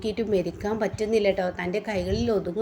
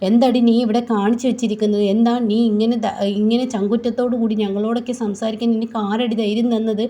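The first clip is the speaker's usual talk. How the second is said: loud, raised confrontation; angry demand; 5 dB louder than usual. Acted. Indraja angrily confronting Nandini. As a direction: demand who gave her the nerve.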